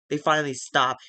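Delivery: usual speaking voice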